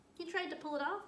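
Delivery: plain reading voice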